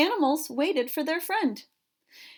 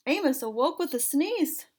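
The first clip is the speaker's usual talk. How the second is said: usual speaking voice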